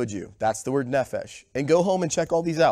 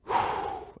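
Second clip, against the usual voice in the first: long breathy puff, no voice; winded exhale; 5 dB quieter than usual. Retold or imitated. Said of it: "And I was like".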